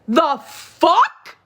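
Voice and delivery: rising disbelieving outrage; outraged. Real, not acted. Repeats louder, higher.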